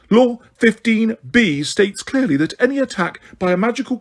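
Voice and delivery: high, prim warning voice; a shrill official lecturing, barely holding it; lightly voiced Umbridge, frightened but pompous. Umbridge citing the law.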